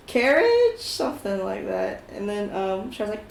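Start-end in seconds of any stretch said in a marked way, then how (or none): 0.1-0.8 higher, uncertain rising word; higher uncertain guess; unsure question. Retold or imitated. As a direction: guess it tentatively. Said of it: "he's like".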